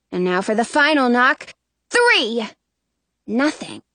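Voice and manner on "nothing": usual speaking voice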